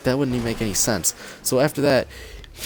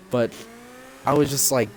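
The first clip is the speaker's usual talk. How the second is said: ordinary talk, nothing marked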